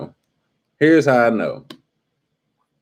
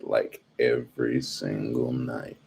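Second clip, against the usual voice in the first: low, slow and soft, suggestive; suggestive aside; 8 semitones lower, 10 dB quieter, slower than usual. Acted. Telling friends about a neighbor, low and slow. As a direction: drop it low and slow, knowing.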